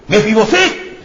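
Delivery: high, loud call of a name; David calls Mefiboset by name, high and loud. Acted. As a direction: call him across the hall. King calls the man.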